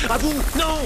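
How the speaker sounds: high, loud cry of warning; alarmed shout. Acted. Aladdin sees his monkey grab the ruby, alarmed shout. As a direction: yell to stop him, voice leaping up.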